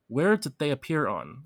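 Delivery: normal talking voice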